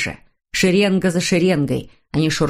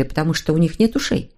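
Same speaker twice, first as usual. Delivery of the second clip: ordinary delivery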